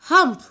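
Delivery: loud, high, dismissive "Hump" grunt; rude hump. A narrator's reading. Camel rudely grunts hump at the ox. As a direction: shut the ox down with one rude grunt. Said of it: "replied in a very rude manner".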